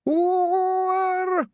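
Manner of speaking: high wailing oor; a long piercing pixie moan. A narrator's reading. Bad poet moaning to the clearing, high wailing. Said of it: "Somewhere a voice went".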